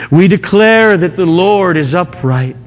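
high, strong proclamation; preaching. Acted. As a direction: declare it to the congregation. Final declaration of the verse.

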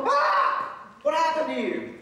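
high-pitched startled yelp, then alarmed question; freaking out. Acted. People seeing Moses' glowing face, acted freak-out. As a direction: act out a crowd flipping out, pitched high. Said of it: "They were freaking out".

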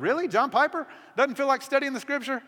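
mock disbelief at the idea; incredulous. Speaker's incredulous reaction to Piper.

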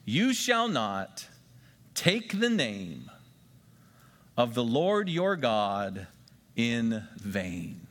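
slow, deliberate dictation pace; measured memory-verse reading. Shown as a demonstration. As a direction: read it slowly so they can write. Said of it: "let's write something down to help us remember".

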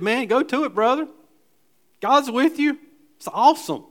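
high, loud, hearty encouragement; bright pumped-up "go for it" voice; Nathan cheering. Retold or imitated. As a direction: cheer him on like an excited friend.

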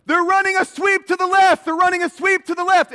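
yelling the play call across the line; linebacker shouting. Retold or imitated. Linebacker yelling across the line at the offense, loud shout. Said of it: "the linebacker's going".

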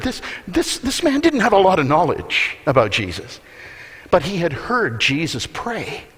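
ordinary talk

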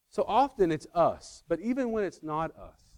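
ordinary delivery

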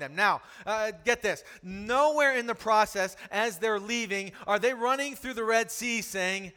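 loud, driving sermon voice; full-force preaching. Acted. Preacher to the congregation, a full-force sermon. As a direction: preach it hard to the room.

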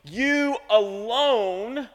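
"You alone" very slow with a rising end; slow emphasis. Shown as a demonstration. Pastor rereading the verse, drawn out and stressed. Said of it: "skip down to verse fourteen".

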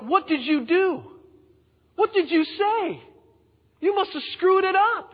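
high, incredulous complaining voice; accusing crowd. Acted. The Israelites blame Moses, in a high accusing whine. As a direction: whine at him in high disbelief.

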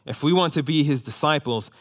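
normal talking voice

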